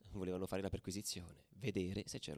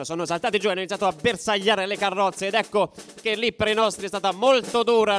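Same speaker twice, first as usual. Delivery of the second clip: much higher and louder, pushing through the brawl like a war report; fired-up battle telling; 22 dB louder than usual. Acted. Ultra reliving the train ambush for listeners, loud and excited. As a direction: tell the train fight at full shout, breathless with it. Battle scene told at full force.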